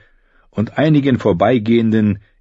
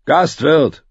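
high, raised-voice summons; angry lord calling for service; bellowing; 8 semitones higher than usual. A narrator's reading. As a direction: call out imperiously for the innkeeper. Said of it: "brüllte er".

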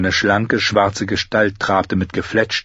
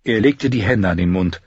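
plain reading voice

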